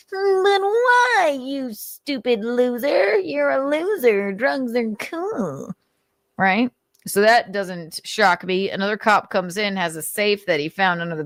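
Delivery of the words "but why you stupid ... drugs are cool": high, slow whiny sneer; squealing mock taunt; mocking Nick. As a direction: jeer like a bully calling him a loser. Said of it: "Nick's like".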